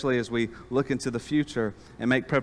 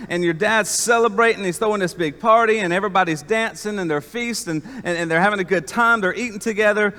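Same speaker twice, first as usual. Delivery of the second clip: excited servant news; 6 semitones higher, 5 dB louder than usual. Acted. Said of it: "So he says".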